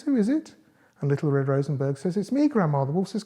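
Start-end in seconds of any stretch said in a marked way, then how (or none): 0.0-0.5 high, lilting fake granny; wolf faking a high granny voice; put-on grandmother voice. A narrator's reading. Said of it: "puts on the grandmother's voice". 2.2-2.8 put-on high little-girl voice; high-pitched girl announcing herself; Little Red Rosenberg. A narrator's reading. Girl answers at the door.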